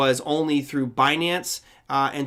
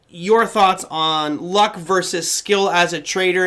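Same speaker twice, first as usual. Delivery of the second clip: normal talking voice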